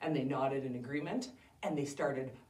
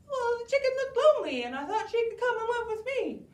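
high, quick sweet excuse; a kid talking his way out of trouble; Jack's voice, high and fast; innocent boy Jack; 8 dB louder, faster than usual. Acted. Jack explains to giant.

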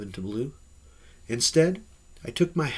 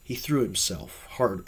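plain reading voice

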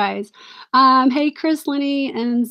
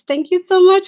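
higher and louder, falling on much; gushing thanks; 6 semitones higher, 5 dB louder than usual. Real, not acted. Gushing thanks to Carol for the brooch she sent. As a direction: burst out with thanks.

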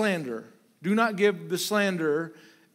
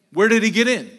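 ordinary talk, nothing marked

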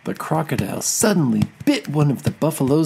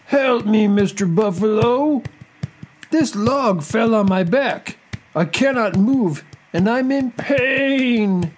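high, drawn-out crocodile wail; pained, high-pitched cartoon crocodile cry; 10 semitones higher, slower than usual. A narrator's reading. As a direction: plead for rescue, stretching out the last words. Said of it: "cried the crocodile".

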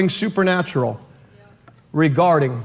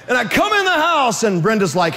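ordinary delivery

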